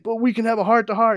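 pleading Jor-El. Retold or imitated. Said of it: "He's like".

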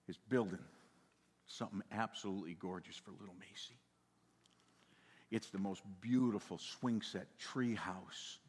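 soft, low hushed secret; confidential. Real, not acted. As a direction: lean in and share a secret.

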